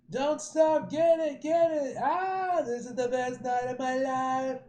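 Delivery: high, loud reveller's whoops; party cheering. Acted. Partygoer yelling about the best night, ecstatic shouting. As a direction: shout it out, wildly excited.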